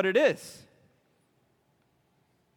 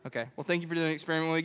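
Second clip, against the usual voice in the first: ordinary delivery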